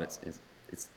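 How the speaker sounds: stammered whisper, barely voiced; awestruck whisper. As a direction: whisper it, frozen with star-struck awe. Star approaches, speaker awestruck.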